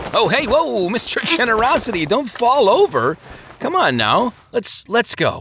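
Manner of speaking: high, hurried alarm; steady a tottering drunk friend; startled coaxing. A narrator's reading. Greatheart catches his toppling friend, alarmed and coaxing.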